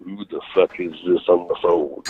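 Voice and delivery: softly voiced irritated veteran; Harley Race impression. Fired-up Harley challenges the prank caller, understated Harley Race impression. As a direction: demand who's calling, with understated menace. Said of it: "get him fired up".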